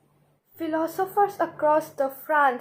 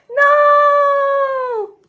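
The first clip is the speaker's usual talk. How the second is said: long, high, loud held cry; wail of dismay; 13 semitones higher, 10 dB louder than usual. Acted. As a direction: howl the word in dramatic despair. New king dreads his duties.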